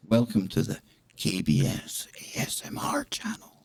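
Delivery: soft ASMR channel intro; ASMR whisper. Shown as a demonstration. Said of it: "one of those really good videos".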